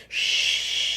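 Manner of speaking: long hissing shh of pouring water; imitated water noise. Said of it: "he's making water noises".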